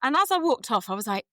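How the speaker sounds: normal talking voice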